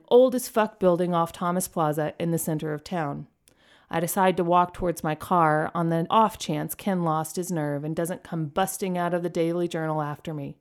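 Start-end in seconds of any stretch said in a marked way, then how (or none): none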